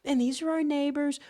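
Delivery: mocking whiny voice; mocking allies line. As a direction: sneer it like a naive diplomat. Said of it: "Anytime you hear this crap about how".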